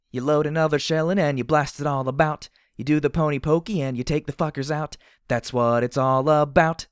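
high, very loud gleeful chant shouted out; shouting. A narrator's reading. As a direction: holler the rhyme at full volume. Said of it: "I shouted as I blasted".

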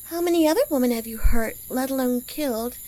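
ordinary talk

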